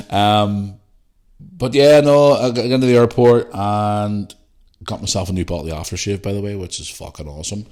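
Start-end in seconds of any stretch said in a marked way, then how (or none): none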